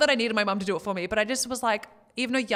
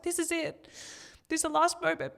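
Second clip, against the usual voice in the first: high, small, choked-up voice; higher-pitched, holding back tears; tearful farewell; 8 semitones higher than usual. Retold or imitated. Tearful farewell to her baby, retold.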